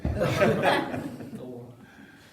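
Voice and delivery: high burst of laughter; hearty laugh. Real, not acted. Laughing at his own joke. (carcajada aguda)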